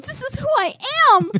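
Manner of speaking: high, hammed-up diva proclamation; dramatic declaration. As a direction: overplay it like a grand proclamation.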